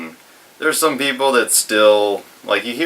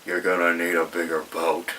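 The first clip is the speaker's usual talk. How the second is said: cigarette in mouth; 3 semitones lower, 6 dB quieter than usual. Retold or imitated. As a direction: quote mumbled around a cigarette. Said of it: "said it with the cigarette in his mouth".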